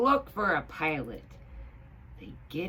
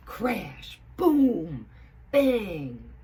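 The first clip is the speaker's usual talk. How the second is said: dramatic crash; 5 semitones higher, 9 dB louder, much slower than usual. A narrator's reading. Sound effects read dramatically.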